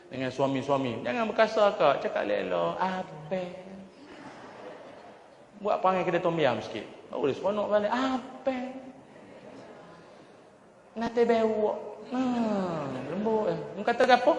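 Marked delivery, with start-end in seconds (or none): 2.8-3.4 wife asking back. Acted. 7.9-8.6 wife asking back. Acted. 10.9-13.5 soft, higher gentle calling in Kelantan dialect; a lightly voiced Kelantanese wife calling softly. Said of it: "Lembut panggil". 13.7-14.4 put-on Kelantan accent. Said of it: "Kelantan spouse asking".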